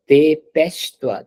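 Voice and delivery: "dépêche-toi" drawn out slowly, silent final E; correct French model. Shown as a demonstration. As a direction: say "dépêche-toi" as de-pesh-twa, no A after sh. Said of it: "Whenever a word is ending with E, it's not A sound".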